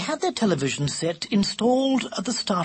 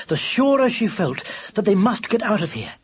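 plain reading voice

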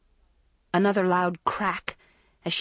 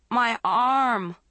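a mild scream, a bit higher and louder; scream of pain; 4 semitones higher, 3 dB louder than usual. A narrator's reading. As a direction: cry out in pain after the fall. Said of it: "Dawn screamed".

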